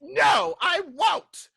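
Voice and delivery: very high, loud goblin shout; squealing, petulant refusal; defiant goblin. Acted. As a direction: shout back like a stubborn little goblin. Bebo refuses from behind the door.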